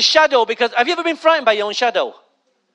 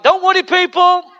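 high, loud mock announcement; bold proclamation; 5 semitones higher, 4 dB louder than usual. Prophesied-over passenger reassuring everyone aboard, loud proclamation. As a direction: call it out to the whole cabin.

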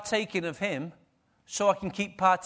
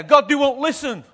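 loud and high, pleading in exasperation; frustrated cry to God; 7 semitones higher, 9 dB louder than usual. Retold or imitated. Preacher imitating his own frustrated prayer, loud and high. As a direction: throw up your hands and cry out to God. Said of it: "I could get really frustrated and say".

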